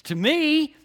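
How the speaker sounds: "To me" punched high and strong; emphatic. Retold or imitated. Preacher showing the Greek emphasis at sentence start, emphatic. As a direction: throw all the weight on "To me".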